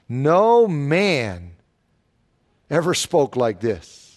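"No man" leaned on, then "ever spoke like this" dropped low; stress on man. Shown as a demonstration. Preacher stressing "man" in the quote. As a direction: press the word "man", let the rest fall low. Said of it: "the emphasis is on the word man".

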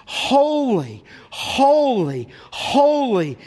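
each HOLY drawn out and falling; emphatic repetition. Shown as a demonstration. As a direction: lean long on every "holy", set apart. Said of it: "statement of Scripture with emphasis".

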